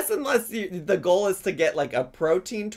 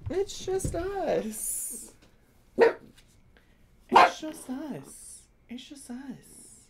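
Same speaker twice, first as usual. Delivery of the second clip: soothing pet talk; 12 semitones higher than usual. Acted. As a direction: hush a scared pup gently, pitch up. Soothing dog after buzzer scare.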